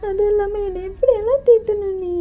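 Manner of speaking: high, pleading voice with crying breaks; tearful young lover. Acted. JK pleads with Dev not to leave, high and tearful. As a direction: beg him not to leave you, close to sobbing. JK complains.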